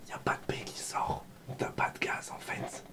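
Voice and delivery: playful voiceless whisper, close to the microphone. Real, not acted. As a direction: whisper it gently to your little one. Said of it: "ça doit faire bizarre que je chuchote".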